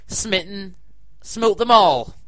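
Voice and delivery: a hint of a wrathful god; lightly voiced smiting god. Mock godlike voice.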